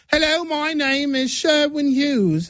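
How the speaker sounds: high, drawn-out mock English accent. Shown as a demonstration. Demonstrating accent from the Great Britain area, English accent. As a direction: put on a high-pitched, stretched British accent. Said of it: "the English accent from England".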